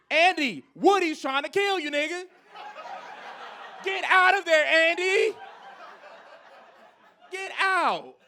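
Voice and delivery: panicked shouting at Andy; yelling at the screen. Pretend. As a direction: shout a warning to a kid in danger. Yelling warning at movie screen.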